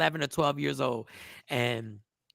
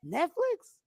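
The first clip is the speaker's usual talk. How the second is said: incredulous; 10 semitones higher than usual. Real, not acted. Disbelief at Netflix shelving the film.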